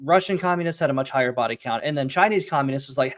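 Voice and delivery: ordinary delivery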